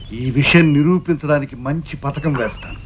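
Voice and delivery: low, slow plotter's voice; scheming. Acted. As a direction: hatch the plot slowly, pitched low. Schemer hatches a plot. (కుట్ర పన్నుతున్న స్వరం)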